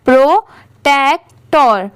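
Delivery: Protector said slowly, syllables pro-tek-tor joined one by one; slow blended read. Shown as a demonstration. Teacher joining syllables for her students, slow and deliberate. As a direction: stretch out protector as you join its parts. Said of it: "अब इन तीनों को साथ में मिला देते हैं".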